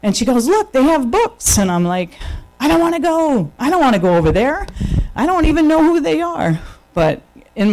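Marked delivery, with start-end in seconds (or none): 0.5-1.6 high, slow delighted exclaim; excited about books. Retold or imitated. 2.6-6.6 high, whiny protest; reluctant whine. Retold or imitated. Reluctant self quoted, whining.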